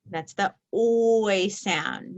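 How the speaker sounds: "oy" drawn out and stressed; slow stress. Shown as a demonstration. Teacher highlighting the oy sound for students, stressed. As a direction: lean slowly on "oy". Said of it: "teacher stresses the sound".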